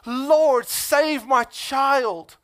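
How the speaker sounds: loud, slow, high plea to God; David begging God to save his child, loud and slow; pleading prayer. Retold or imitated. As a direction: cry out to the Lord, drawn out. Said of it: "He was like".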